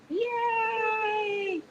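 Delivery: high, long-held "yay"; sustained squeal of mock celebration; acted cheer. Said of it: "When it happens, I'm like".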